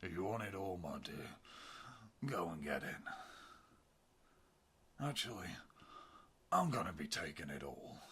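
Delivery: a cold, sneering bad guy; villain voice. Villain speaks in story.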